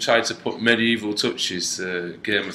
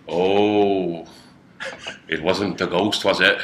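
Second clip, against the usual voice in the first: low-pitched, put-on ominous owner; hotel owner; 6 semitones lower, slower than usual. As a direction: drop the pitch for a spooky local. Voices the hotel owner in reply.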